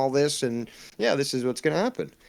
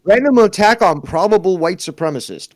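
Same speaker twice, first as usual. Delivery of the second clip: mock headline read; 6 semitones higher, 9 dB louder than usual.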